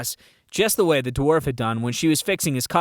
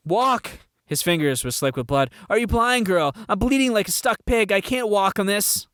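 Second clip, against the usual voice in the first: frantic outrage; 7 semitones higher than usual. Acted. Guard indignant, bleeding.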